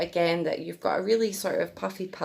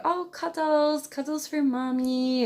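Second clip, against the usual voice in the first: put-on baby talk; 10 semitones higher than usual. Shown as a demonstration. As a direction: draw it out sweetly to the baby. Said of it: "let them know".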